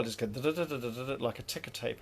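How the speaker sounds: ordinary talk, nothing marked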